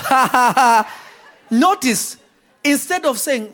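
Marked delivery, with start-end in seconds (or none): 0.1-0.9 short scornful ha-ha-ha; mocking laugh. Mourners laughing at Jesus, mock scornful laugh. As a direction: laugh in their faces, mockingly. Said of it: "They even laugh".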